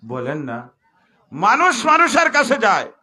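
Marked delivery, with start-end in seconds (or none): 1.3-2.9 very high, loud sermon shout; shouted punchline. Acted. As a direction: yell the punchline to the crowd. (জোরে চিৎকার করে)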